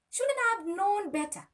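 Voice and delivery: high, loud anguished self-reproach; anguished cry. Real, not acted. Telling of her own trauma, a high anguished outcry. Retelling self-blame after assault.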